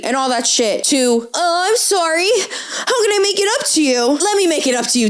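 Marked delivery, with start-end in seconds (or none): 1.3-5.1 high, wheedling mock apology; exaggerated sing-song pleading; mock apologetic. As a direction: imitate a grovelling suitor, pitched way up. Mimicking the suitor's sudden apology.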